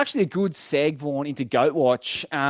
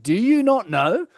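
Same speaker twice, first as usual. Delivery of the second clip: higher, louder disbelieving question; mock incredulous; 7 semitones higher, 4 dB louder than usual. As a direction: Rasta can't believe the other guy, pitched up. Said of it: "like, incredulous".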